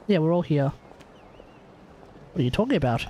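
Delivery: ordinary delivery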